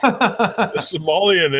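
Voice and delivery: very slow, stretched "A Somalian"; drawn-out Somalian. Shown as a demonstration. Repeating the misheard word "Somalian" slowly. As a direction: linger on "Somalian" mockingly. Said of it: "sounds like he says".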